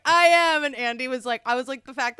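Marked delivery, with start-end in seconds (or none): 0.0-0.6 proud admission. Retold or imitated. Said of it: "And she was like".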